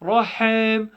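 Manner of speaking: "Rahim" said as "Rahem"; passive vowel. Shown as a demonstration. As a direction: open e in the last syllable of "Rahim". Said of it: "You're not praying Rahim".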